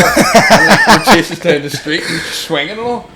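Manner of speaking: talking through high laughter; laughing. Real, not acted. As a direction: laugh while telling the chase. Laughing retelling chase.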